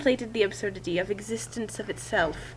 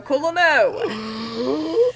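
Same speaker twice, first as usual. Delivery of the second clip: drawn-out gasp; 5 semitones higher, 6 dB louder, much slower than usual. Acted. Dramatic reading of an emoticon.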